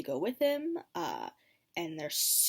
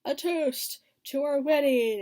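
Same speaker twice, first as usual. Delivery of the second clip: hammed-up Ramsay toast; 6 semitones higher, 7 dB louder than usual. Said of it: "Ramsay's like".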